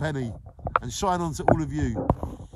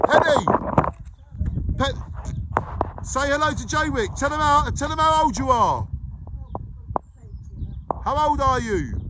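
much higher, sweet coaxing tone; 9 semitones higher, 6 dB louder than usual. Real, not acted. To elderly Penny, urging her to greet Jaywick, high coaxing coo. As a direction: coo at her gently to coax out a word.

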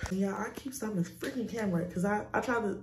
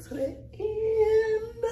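strained voice; much slower than usual. Real, not acted. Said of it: "my voice just went out".

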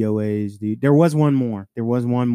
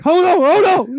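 very high, loud imitation of panic; panicked retold yell; 7 dB louder than usual. Removal guy yelling up from under the body, panicked. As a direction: yell it like the load is slipping.

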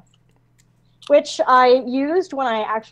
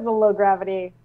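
ordinary delivery

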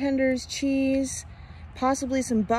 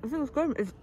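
talking through a mouthful; chewing while talking; mouth full; 4 semitones higher, 4 dB quieter, faster than usual. Real, not acted. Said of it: "sorry to talk with food in my mouth".

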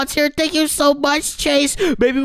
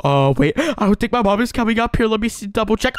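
ordinary delivery

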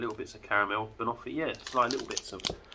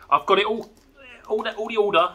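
excited; 12 semitones higher, 7 dB louder than usual. Real, not acted. Thrilled about saving the best.

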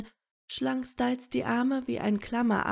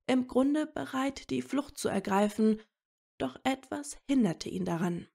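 ordinary delivery

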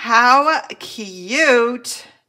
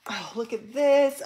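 ordinary delivery

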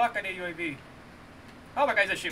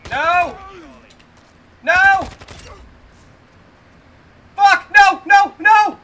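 loud, high cries of protest; frustrated shouting; 5 semitones higher, 12 dB louder, slower than usual. Real, not acted. Player watches the match slip away, frustrated shouting. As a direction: shout each word as its own outburst.

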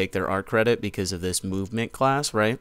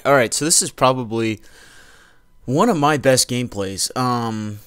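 usual speaking voice

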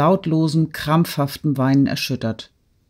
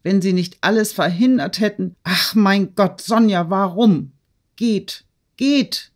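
distraught outburst; 5 semitones higher than usual. A narrator's reading. Natalie's distraught outburst after crying out.

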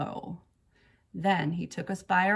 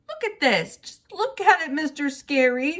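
high, loud indignant grouching; loud childish complaint; grouchy; 9 semitones higher, 8 dB louder than usual. A narrator's reading. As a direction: grouch it out, demanding the teacher look. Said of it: "she grouched".

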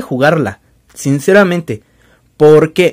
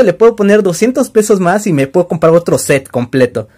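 ordinary talk, nothing marked

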